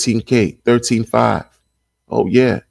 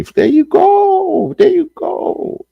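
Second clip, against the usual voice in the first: singsong cheer; 4 dB louder, much slower than usual. Real, not acted. Celebrating a Bitcoin-over-altcoins call.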